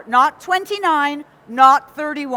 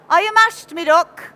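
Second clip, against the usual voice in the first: broad Nottinghamshire accent, pitched higher and louder; 4 semitones higher, 4 dB louder than usual. Shown as a demonstration. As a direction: shout a friendly Nottinghamshire greeting in the local accent. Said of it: "I can still speak Nottinghamshire".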